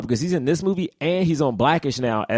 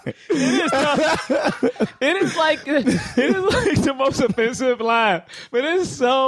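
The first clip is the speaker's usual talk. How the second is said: high-pitched, talking through laughter; drawn out, fighting real laughter; laughing; 8 semitones higher, much slower than usual. Real, not acted. Helpless laughter at offensive movie line.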